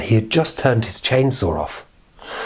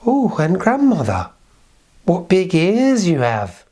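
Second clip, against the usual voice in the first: put-on little girl; 8 semitones higher, slower than usual.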